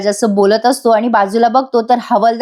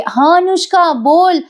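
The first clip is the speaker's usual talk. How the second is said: higher, sugary phone greeting; put-on phone voice; 7 semitones higher, slower than usual. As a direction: pretend it's a girlfriend calling. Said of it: "Priya आता phone घेते आणि म्हणते". (फोनवर उंच, गोड आवाज)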